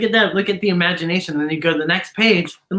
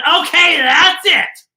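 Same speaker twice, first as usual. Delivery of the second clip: louder, higher "that's it" as the fed-up monster; fed-up outburst; 7 semitones higher, 6 dB louder, slower than usual. Acted. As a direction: throw up your hands and snap it out. Frankenstein fed up, voiced.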